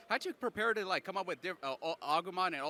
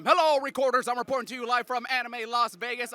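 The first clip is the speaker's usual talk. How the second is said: loud, high announcer greeting; big, projected on-air hello; show opener; 6 semitones higher, 14 dB louder than usual. Real, not acted. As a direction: kick off the interview like a roving reporter.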